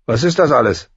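suspicious brother's query. A narrator's reading. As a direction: ask it with open distrust. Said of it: "Argwöhnisch".

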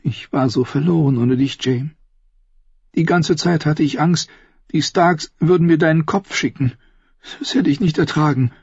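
high-pitched woman's voice, yearning; anguished Cersei. Acted. Sister telling her lost brother how afraid she was, high yearning voice. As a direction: confess your fear in a high, tender voice.

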